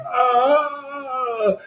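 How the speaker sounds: long high fake moan of pain; hammed-up dying wail; faked dying cry. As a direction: pretend you're dying, drawn out. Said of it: "pretending I'm dying".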